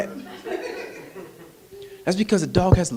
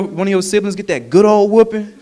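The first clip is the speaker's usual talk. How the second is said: ordinary delivery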